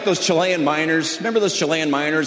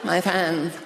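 mock distraught; 2 semitones higher, 10 dB quieter, much slower than usual. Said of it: "She's like".

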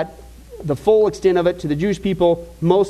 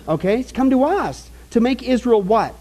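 ordinary talk

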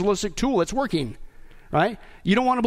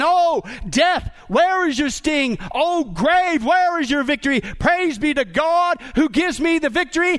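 higher, louder, slow triumphant cry; triumphant yell; 5 semitones higher, 3 dB louder, slower than usual. Retold or imitated. Dying father-in-law yelling in triumph from his bed. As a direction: proclaim victory over death at full voice. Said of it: "with full triumph in his voice".

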